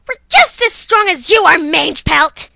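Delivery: the stronger take of the challenge; defiant shout. Acted. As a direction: spit out Mange Pelt as a proper name. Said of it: "I'm saying Mange Pelt like it's a name".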